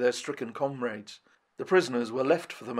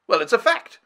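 higher, louder blunt retort; teasing lord; 10 semitones higher, 7 dB louder than usual. A narrator's reading. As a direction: shrug it off cheekily. Lord John.

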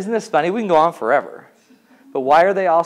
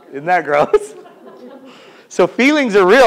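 usual speaking voice

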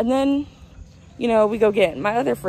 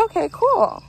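pleased; 7 semitones higher than usual. Retold or imitated. Friend offers birthday shot.

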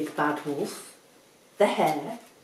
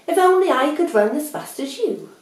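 high, louder wishful admiration; admiring; 10 semitones higher, 9 dB louder than usual. Acted. Mole envies the speedy hare, high admiring gush. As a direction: gush it with pitch lifted. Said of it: "added Mole admiringly".

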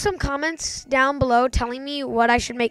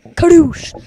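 loud, high launch shout; catchphrase yell; 6 semitones higher, 10 dB louder than usual. Real, not acted. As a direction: yell the catchphrase as the bird flies. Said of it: "forgetting to yell Kadoosh".

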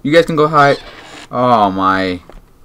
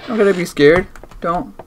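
normal talking voice

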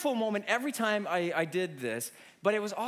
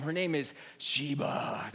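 slow, heavier and more ominous; ominous repeat; 7 semitones lower, slower than usual. Shown as a demonstration. Preacher repeating the dog's name for effect, slow and ominous. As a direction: let the name send chills. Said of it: "Let me say that one more time".

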